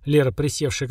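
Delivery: ordinary delivery, nothing marked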